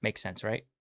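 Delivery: ordinary delivery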